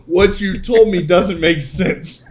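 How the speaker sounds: slow, flat-stated boss; slow deadpan manager. Retold or imitated. Manager telling the rookie it makes no sense, slowly. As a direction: lay it out slowly. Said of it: "he goes".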